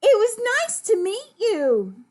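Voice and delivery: put-on very high, loud little-girl farewell. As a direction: call a polite goodbye as a little girl. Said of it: "the little girl goes".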